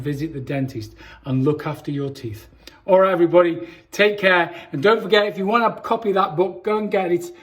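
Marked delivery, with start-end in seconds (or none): none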